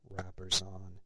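ordinary delivery, nothing marked

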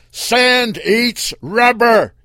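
loud, slow yell across the sand. Acted. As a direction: shout it out over the wind. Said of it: "he yelled".

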